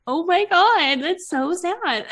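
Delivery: high, slow, teary "Oh my God, that's so sad"; sappy teary gush. Retold or imitated. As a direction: gush like a weepy new mom at the TV. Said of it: "without being like".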